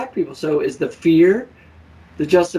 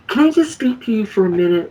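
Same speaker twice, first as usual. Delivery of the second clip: higher, faked-sincere "Can I just speak to you"; mock polite request, higher; mock sweet Karen voice; 6 semitones higher, 3 dB louder than usual. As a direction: imitate a fake-polite Karen, pitched up. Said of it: "You know, they go".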